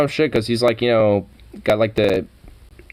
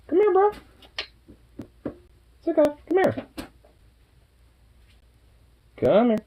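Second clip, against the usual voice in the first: squeaky praising a dog. Real, not acted. Baby-talking to the dog Sukov.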